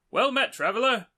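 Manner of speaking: high-pitched, hammed-up Australian; badly put-on Aussie traveller; terrible Australian accent. Lost traveller hailing a knight, bad Australian accent. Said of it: "sorry for the terrible Australian accent".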